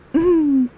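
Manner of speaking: loud high giggle; a happy teddy laughing; bear laugh. Acted. Pooh Bear laughing goodbye, loud and high.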